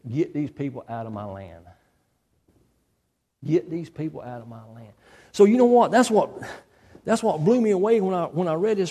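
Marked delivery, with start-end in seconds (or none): 0.1-4.9 low, hushed royal order; soft, lowered voice of a beaten king; defeated Pharaoh. Acted. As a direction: give up and send them away quietly. Bereaved Pharaoh orders them out.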